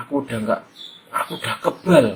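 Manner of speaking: lightly voiced proud little boy; a hint of a boy bragging; boastful kid. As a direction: brag like a cocky kid.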